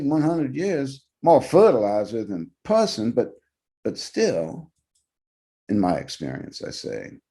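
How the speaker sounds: put-on an old cop musing uneasily; light old guard voice. A narrator's reading.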